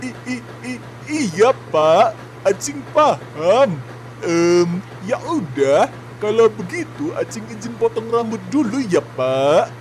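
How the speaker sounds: high, stammering meek voice; high-pitched squeaky underling who is apologising to the boss; timid intern. Acted. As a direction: stutter nervously like a scared young intern. Intern Acing's timid put-on voice.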